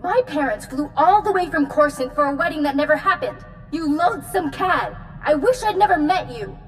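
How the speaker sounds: indignant tirade. Acted. Jilted bride berates Doc over the ruined wedding, indignant.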